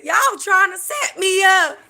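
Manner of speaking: high drawn-out accusing cry. Retold or imitated. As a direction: shout it like a paranoid diva. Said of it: "and she said".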